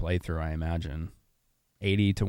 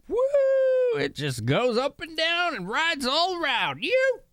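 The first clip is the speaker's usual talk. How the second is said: Ronfar. Pretend. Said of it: "With that voice for some reason".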